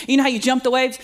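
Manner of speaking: ordinary talk, nothing marked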